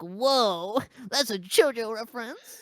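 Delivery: mocking impressed-fan voice; awestruck fan. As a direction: imitate a fan making a big discovery. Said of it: "people are like".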